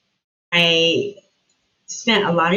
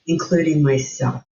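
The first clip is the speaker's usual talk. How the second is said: ordinary talk, nothing marked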